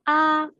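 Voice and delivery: "Ah" held high and level; "Ah" sustained on one pitch; flat first tone. Shown as a demonstration. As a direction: open wide on a steady "Ah", as for the dentist. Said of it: "est un ton plat".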